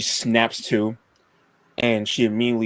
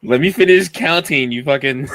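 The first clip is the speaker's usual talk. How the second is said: higher and louder, laughing mock annoyance; playful exasperation; 4 semitones higher, 7 dB louder than usual. Real, not acted. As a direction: snap at your friend, half laughing. Player interrupted while counting dice.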